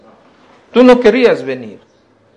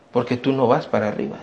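ordinary talk, nothing marked

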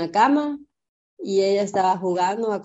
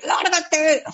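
snapping at husband; 8 semitones higher than usual. Retold or imitated. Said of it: "Y yo de que".

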